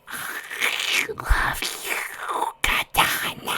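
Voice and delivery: very slow, whispered monster voice; voiceless, burbling farewell from a creature; creature burble. A narrator's reading. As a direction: draw each word out in a watery whisper. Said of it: "the creature burbled".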